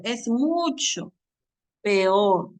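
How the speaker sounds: slow, emphatic "peor" as pe-OR; deliberate model phrase, "peor" set apart after a pause; careful corrected peor. Shown as a demonstration. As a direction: draw out "mucho", then a firm two-beat "peor".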